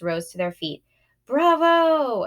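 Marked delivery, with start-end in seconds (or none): 1.2-2.2 high, loud cheer at the curtain call; yelling bravo. A narrator's reading. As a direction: shout it through cupped hands. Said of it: "I heard Dad yelling through his hands".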